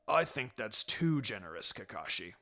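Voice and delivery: put-on a deadpan old pug; Pakun's gruff voice. A narrator's reading. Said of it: "Pakun said".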